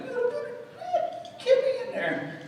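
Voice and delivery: very high squeaky voice. Pretend. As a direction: squeak out the little part. Squeaky tiny emphasis.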